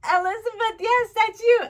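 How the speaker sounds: high, loud "Elizabeth" as one joined word; delighted teacher praise. Pretend. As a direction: cheer on a small pupil, saying "Elizabeth" whole. Teacher cheering on a young pupil.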